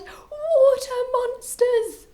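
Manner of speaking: loud, very high, drawn-out wail; panicked cry. A narrator's reading. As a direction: shriek in fright at monsters. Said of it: "And one cried".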